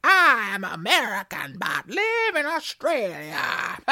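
broad, slow mock-American drawl; hammed-up American accent, showing off. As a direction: stretch every word in a comic American accent. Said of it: "do it in an American accent".

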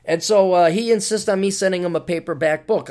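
normal talking voice

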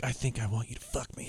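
hushed, mock-seductive whisper. Woman propositions the man at the hotel, seductive whisper. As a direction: whisper the come-on under your breath.

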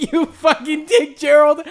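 laughing curse. Acted. Son swears at Gerald over the tape, laughing. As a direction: curse him while cracking up.

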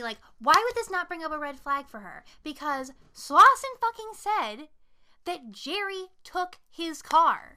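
high, emphatic disbelief; incredulous, drawn-out complaint; real exasperation. Real, not acted. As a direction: argue it in exasperation, slowing to hammer each word. Exasperated at the film's heroine.

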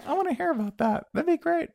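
put-on high-pitched child voice; light, high child impression; curious kid. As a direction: ask eagerly like a small kid. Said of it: "the kid would be like".

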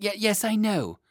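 put-on high, loud boy cutting in; impatient interruption. A narrator's reading. Lionel interrupting the Chancellor, high impatient boy voice. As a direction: cut in quickly in a high child's voice. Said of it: "Lionel interrupted".

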